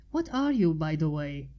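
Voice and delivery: curious rising question; a little intrigued. Acted. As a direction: lean in, intrigued. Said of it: "sounding a little intrigued".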